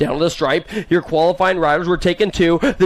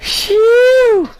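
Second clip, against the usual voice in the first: much higher, louder hype whoo; 14 semitones higher, 4 dB louder than usual. Real, not acted. Announcer whooping after teasing a rider. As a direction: let out a whoop.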